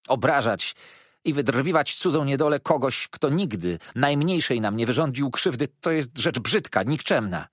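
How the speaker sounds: heated reproach from a wronged teacher; indignant rebuke. A narrator's reading. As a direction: scold a pupil in righteous outrage. Teacher rebukes pupil for insult.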